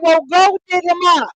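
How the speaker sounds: high, loud mock comeback relished by the teller; retold comeback. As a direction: belt out the quoted punchline high and loud. Punchline repeated in retold quarrel.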